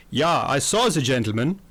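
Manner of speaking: put-on a stiff German butler's accent. The German butler confirms the alibi, German accent. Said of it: "Hans, the German butler".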